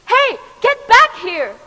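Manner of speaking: put-on high, loud shout of a little boy; a boy shouting to stop the escaping cake; yelling boy. A narrator's reading. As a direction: yell after the runaway cake. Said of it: "yelled the little boy".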